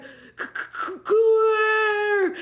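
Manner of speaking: breathy stutters bursting into a high cry; stammer, then wail the name; mock struggling stammer. Alligator Steve recognising Claire, mock strangled stammer.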